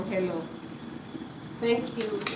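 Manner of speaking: ordinary talk, nothing marked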